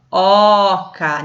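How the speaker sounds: "oca" with a long, wide-open ó; open stressed O. Shown as a demonstration. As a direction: lean loudly into the first vowel of "oca". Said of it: "Como se tivesse um acento agudo".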